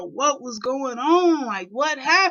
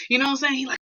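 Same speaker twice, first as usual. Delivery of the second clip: usual speaking voice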